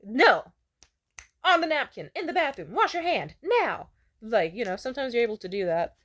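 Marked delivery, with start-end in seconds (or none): 0.0-3.9 scolding yell. Retold or imitated. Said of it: "be like".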